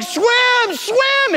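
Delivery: high shouted urging; urgent shout. Retold or imitated. Boat crowd urging Mary on, high shout. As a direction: yell at her to keep swimming. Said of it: "they're like".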